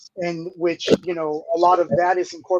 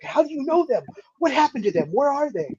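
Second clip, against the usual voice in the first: eager fan questions, a little higher; mock excited questions; 7 semitones higher than usual. Fans quizzing Drew about the band, mock excited. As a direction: play the fans lightly freaking out. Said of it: "they would just freak out".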